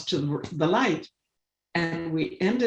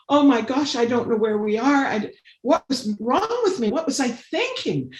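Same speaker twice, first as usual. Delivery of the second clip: sudden panic; 7 semitones higher than usual. Retold or imitated. Lost in the snowstorm at dusk, she panics in a high alarmed voice. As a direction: realise you're lost and panic. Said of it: "I just suddenly went".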